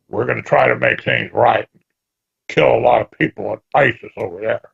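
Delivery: low, gruff, gravelly voice; Henry Kissinger. Acted. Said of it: "says in his gruffly, gravelly voice".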